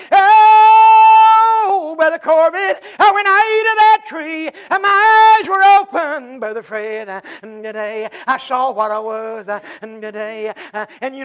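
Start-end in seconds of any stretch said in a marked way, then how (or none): 0.1-6.4 sung, high, loud and slow preacher's whoop; full-force musical whoop over the congregation; whooped preaching. Acted. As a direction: lift it into the chanted climb of a hacking preacher.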